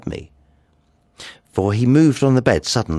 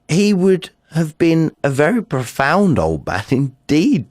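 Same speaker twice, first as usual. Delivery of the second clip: amused laugh; 8 semitones higher, 4 dB louder than usual. Acted. Mocking the old man.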